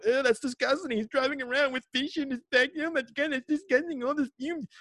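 high whiny mocking voice; imagined Americans complaining about fish vendors; mock disgusted American. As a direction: sneer like a squeamish tourist.

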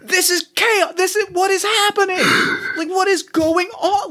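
high, panicked half-whispered shout. Acted. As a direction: squeal it out under your breath in disbelief. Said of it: "I start whisper shouting a little bit".

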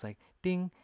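high ringing ding; imitated ding. Mimicking the dings on his car, high ding. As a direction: ring it out high like a bell.

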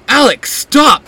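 high, loud slowed plea; a loud, high desperate shout. A narrator's reading. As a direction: shout him down to save her. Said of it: "Magnus shouts".

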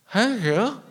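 high, loud chuckling thank you; laughing thanks. Retold or imitated. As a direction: giggle it out absurdly polite. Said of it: "And I say".

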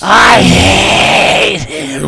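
very slow, low, drawn-out hatred; low, long-drawn mock venom; hammed-up loathing. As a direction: stretch out HATE in a deep voice. Exaggerated hatred of Taz on cue.

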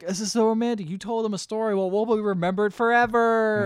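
hammed-up starry-eyed excitement; gushing delight. Clyde's delighted reaction to poem voiced.

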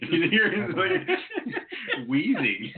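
high-pitched giggle through the words; laughing. Real, not acted. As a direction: crack up while you tease. Laughing at cohost's wheezing.

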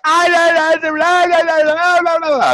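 mock squealing blah blah; squeaky gabble. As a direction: gabble it in a silly falsetto. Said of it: "And I'm like".

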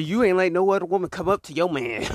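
usual speaking voice

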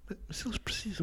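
breathy whispered pleading; soft pleading whisper; whispered plea. Acted.

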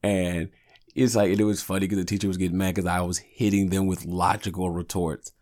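ordinary talk

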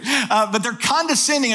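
ordinary talk

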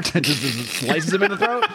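talking through laughter; laughing at the gory panel. Real, not acted. Laughing while retelling the kill.

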